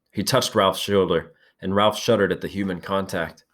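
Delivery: usual speaking voice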